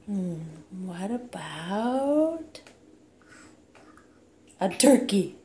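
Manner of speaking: put-on playful sing-song toddler talk; reading to a toddler. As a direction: coax the toddler with animal sounds. Animal sounds game with toddler.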